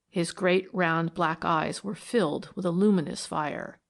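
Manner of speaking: ordinary delivery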